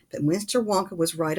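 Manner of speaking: ordinary delivery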